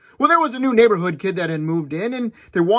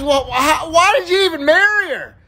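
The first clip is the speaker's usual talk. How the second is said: incredulous buddy; 8 semitones higher, 4 dB louder than usual. Acted.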